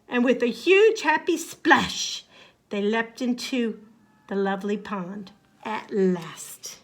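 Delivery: ordinary delivery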